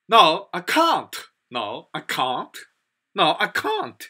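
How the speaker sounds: slow British "can't" with a long ah; British accent. Shown as a demonstration. Teacher showing what they say in Britain, British accent. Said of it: "British, and England, Scotland, Ireland, and they say".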